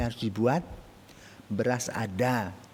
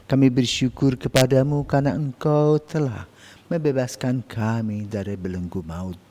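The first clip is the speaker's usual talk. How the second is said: prayer; 5 dB louder than usual. Acted. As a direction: pray aloud to God with fervour. Leads an opening prayer.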